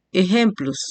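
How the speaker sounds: ordinary talk, nothing marked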